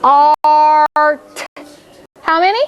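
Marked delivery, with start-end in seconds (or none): 0.0-1.5 ART drawn out sound by sound, held long. Shown as a demonstration. Teacher counting sounds in a syllable for pupils, sounded out. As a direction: stretch ART slowly into its separate sounds. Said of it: "How many sounds are in the syllable start".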